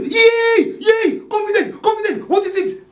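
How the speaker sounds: high screaming cry; screaming the name in panic. Acted. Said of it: "He scream".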